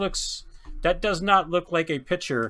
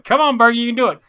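excited cheer; 8 semitones higher, 8 dB louder, much faster than usual. Real, not acted. Cheering for a hit while opening.

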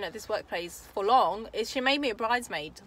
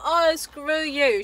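louder, higher and slow, imitating her snap; retold angry outburst; 7 semitones higher, 9 dB louder, much slower than usual. Friend turning on her over the money, mock furious. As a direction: drag out "screw you" in mock fury. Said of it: "She went crazy on me".

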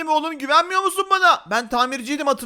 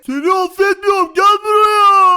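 raised, furious yell; hammed-up angry shouting; angry refusal; 4 semitones higher, 9 dB louder, slower than usual. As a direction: shout it and stretch the ending. [name] refuses apology, gives chase.

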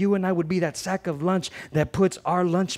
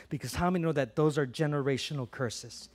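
usual speaking voice

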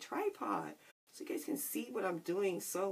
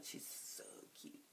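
whispering; 14 dB quieter than usual. Real, not acted. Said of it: "whispered gush".

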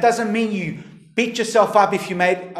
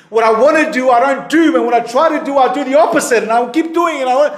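higher, louder anguished confession; Paul's lament; 6 semitones higher, 5 dB louder, faster than usual. Retold or imitated. Preacher voicing Paul's struggle, pleading and louder. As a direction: cry out like a man stuck in his failings. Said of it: "and he talks like this".

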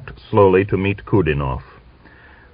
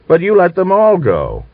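all hit high and loud; scolding him for freeing everyone; reproachful stress; 12 semitones higher, 5 dB louder than usual. Acted. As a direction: punch the word all in reproach.

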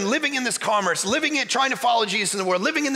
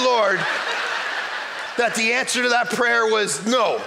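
laughing mock prayer; 2 semitones lower than usual. Man thanking God the prayer failed, laughing.

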